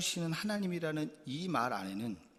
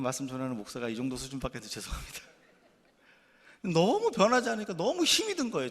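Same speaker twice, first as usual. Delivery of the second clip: ordinary delivery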